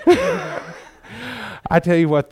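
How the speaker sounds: words squeezed through a laugh, high and drawn out; amused, recovering from a laugh. Real, not acted. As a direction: still laughing as you speak. Still laughing.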